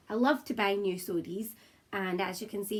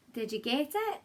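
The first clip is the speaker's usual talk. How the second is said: ordinary delivery, nothing marked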